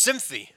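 "sympathy" cut down to two syllables, SIM-fee; "sympathy" said as "symphy"; dropped letters. Retold or imitated. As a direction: swallow the middle of "sympathy". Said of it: "he just forgets tons of letters".

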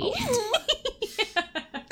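high cackling laugh like a witch; witch cackle. Acted. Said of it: "You sound like a witch".

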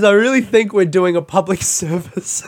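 imitated earnest claim collapsing into laughter; mock-sincere quote broken by a laugh; mock self-justification. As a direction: quote him, cracking up by the end. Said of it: "he goes".

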